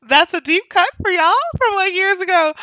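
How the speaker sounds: high, loud, just after laughing; real amusement. Real, not acted. Explaining an old favorite joke, high and amused. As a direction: push it out high through amusement.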